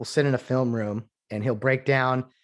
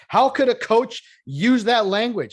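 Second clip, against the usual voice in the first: mock outrage; 10 semitones higher, 8 dB louder than usual. Mocking media outrage.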